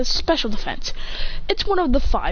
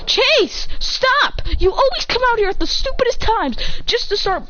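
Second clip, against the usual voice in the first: yelling at the dog; 9 semitones higher than usual. Real, not acted. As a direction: snap at the barking dog to stop.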